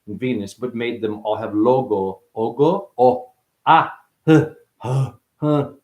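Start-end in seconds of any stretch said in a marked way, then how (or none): 3.0-5.7 short, separate nonsense grunts; weird vocal sounds. Shown as a demonstration. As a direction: puff out odd wordless syllables. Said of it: "Weird sounds".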